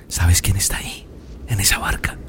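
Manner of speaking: secretive whisper to the sea. Acted. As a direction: lean in and whisper it. (susurro secreto)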